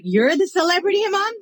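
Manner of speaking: high-pitched incredulous question; teasing disbelief. Retold or imitated. Chaplain teasing the imam on first meeting, incredulous.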